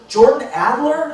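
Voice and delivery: imitated high, incredulous question; surprised recognition. Woman recognising the author she just met, surprised. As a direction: raise the pitch as she realises who it is.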